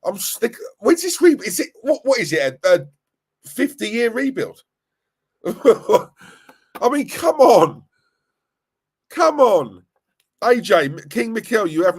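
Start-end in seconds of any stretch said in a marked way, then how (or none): 1.4-9.8 high, loud exasperated laugh-out; can't believe it, come on; louder, higher and incredulous; exasperated disbelief. Real, not acted. Exasperated at rebuild talk.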